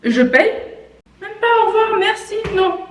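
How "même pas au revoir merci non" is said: high-pitched, incredulous complaint; indignant. Real, not acted. Telling viewers about the rude cashier, high indignation. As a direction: list the missing courtesies, pitched high.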